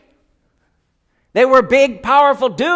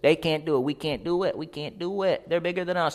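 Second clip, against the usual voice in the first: softer whining complaint; mock bellyaching whine; 3 semitones lower, 8 dB quieter than usual. Spies bellyaching to Moses in a soft whine. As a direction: whimper the excuse over and over. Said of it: "belly aching".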